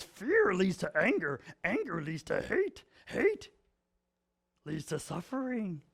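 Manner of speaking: a hint of the little Jedi master; Yoda quote. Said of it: "he goes total Yoda".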